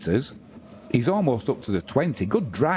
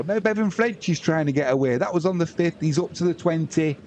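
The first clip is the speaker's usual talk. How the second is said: higher, urgent match call; live play-by-play; 7 semitones higher than usual. Acted. Commentator tracking a breakaway run, urgent play-by-play. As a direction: keep the break alive in the call.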